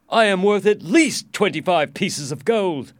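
high, loud indignation; outraged, emphatic protest; angry complaint. A narrator's reading. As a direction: complain loudly with wounded royal pride. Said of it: "he complained angrily".

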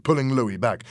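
plain reading voice

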